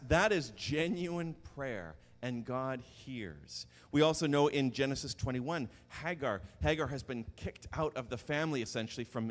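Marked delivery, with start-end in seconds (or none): 0.1-3.7 slow, weighty sermon point; slow emphasis. Real, not acted. Preacher slowing down to stress that God hears. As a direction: draw it out so it lands. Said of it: "slowed emphatic sermon point".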